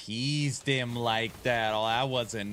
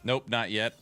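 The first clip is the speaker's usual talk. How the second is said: ordinary talk, nothing marked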